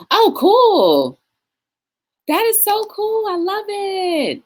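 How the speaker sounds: high, drawn-out excited praise; gushing delight. Real, not acted. Livestreamer loving a viewer's news, gushing.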